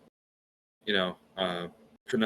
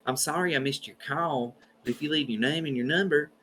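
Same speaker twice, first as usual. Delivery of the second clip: Southern grandmother voicemail; 5 semitones higher, 3 dB quieter than usual. Retold or imitated. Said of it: "how Southern that was".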